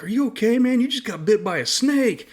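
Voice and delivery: high, urgent retold question; imitated alarm. Fellow soldier alerting him to the bite, a high imitated alarm. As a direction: voice the soldier's alarmed concern, lightly acted.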